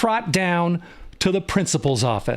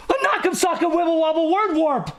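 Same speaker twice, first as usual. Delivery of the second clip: high-pitched exclamation, fully voiced; alarmed cry; 10 semitones higher than usual. A narrator's reading. Shocked listeners echoing the nurse, high alarmed cry. Same echo, cried out high.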